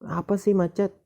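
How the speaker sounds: high, mock-angry outburst at traffic; annoyed driver. Stuck driver venting at the traffic jam, mock irritated. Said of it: "Terus kamu marah".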